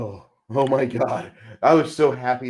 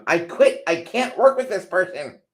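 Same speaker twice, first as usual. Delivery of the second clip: high, loud mock tantrum; fed-up quitting cry, hammed up; mock outburst; 8 semitones higher, 4 dB louder than usual. Man acting a co-worker quitting over a feud, high mock outburst. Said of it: "passive-aggressive game, which ends in".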